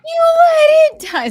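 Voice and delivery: high, loud mock-dramatic shout; mock accusing shout. Movie fan shouts the famous line in mock outrage. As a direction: hurl the accusation in jest.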